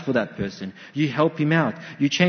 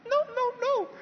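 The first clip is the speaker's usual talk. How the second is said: put-on scared little boy; 4 dB quieter than usual. Child refusing again.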